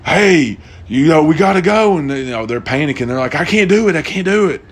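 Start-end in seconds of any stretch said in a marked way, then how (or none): 0.0-2.0 high, louder urging call; a pilot pressing the passengers to jump. Retold or imitated. 3.3-4.6 high whiny panicked pleading; a passenger who won't jump, in mock panic.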